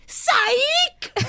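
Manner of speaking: high laughing squeal of psych; imitating a prankster's psych shriek; mock psych. Imitating [name] again.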